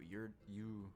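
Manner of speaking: normal talking voice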